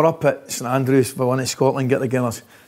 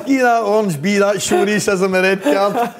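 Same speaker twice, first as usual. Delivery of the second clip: high, slower, giggling repeat of the line; laughing retelling; 7 semitones higher than usual. Retold or imitated. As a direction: savour the punchline while laughing. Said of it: "He says".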